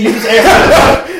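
slow, high and laughing; incredulous laugh through the words; laughing disbelief. Retold or imitated.

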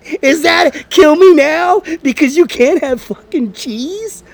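high, loud, slow incredulous rant; mock the overreaction loudly; incredulous mockery. Mocking friend's overreaction loudly.

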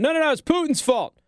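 high mocking whine; mock Biden. Host parodying Biden blaming Putin, high mock whine. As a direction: toss it off as a high mocking excuse. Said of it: "Joe Biden's still running around going".

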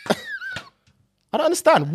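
slow, high words squeezed through a laugh; laughing disbelief. Real, not acted. To friend about the ambulance, laughing in disbelief.

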